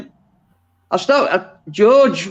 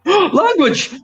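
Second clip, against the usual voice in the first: mock shock; 11 semitones higher than usual.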